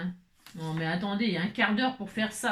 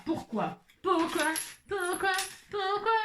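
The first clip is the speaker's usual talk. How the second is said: rising exasperation; 7 semitones higher, slower than usual. Real, not acted. Repeated exasperated why, climbing.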